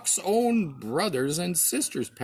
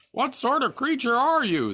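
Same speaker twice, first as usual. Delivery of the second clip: higher and louder jeering wild ducks; mocking cry; 10 semitones higher, 5 dB louder than usual. A narrator's reading. Wild ducks jeering at the duckling, loud mocking cry. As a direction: call it out to taunt. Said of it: "they cried".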